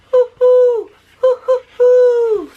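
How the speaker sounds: very high, loud, slow drawn-out hoo; right owl call. Retold or imitated. Showing viewers how the owl really calls, long high hoos. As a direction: show the real owl's call, long and high. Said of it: "The owl says".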